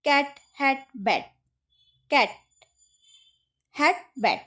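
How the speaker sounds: ক্যাট, হ্যাট, ব্যাট with short open vowels; short A sound. Shown as a demonstration. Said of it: "খুব তাড়াতাড়ি". (ক্যাট, হ্যাট, ব্যাট না টেনে সহজভাবে)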